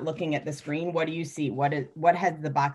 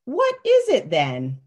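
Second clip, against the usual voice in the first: grown-up asking; 5 semitones higher, 4 dB louder, slower than usual. Acted. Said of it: "says the grown-up".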